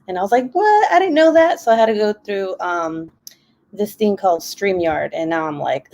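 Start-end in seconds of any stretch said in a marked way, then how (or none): 0.5-1.6 high, louder-pitched outburst of disbelief; retold startled reaction, pitch jumping up; surprised. Vlogger replaying her reaction to YouTube's rule, surprised.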